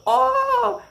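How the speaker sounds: very high, loud startled yelp; mocking Stevie. Stevie recoiling from a banana bite, a high mock yelp. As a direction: squeal it like a startled guy. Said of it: "And he's like".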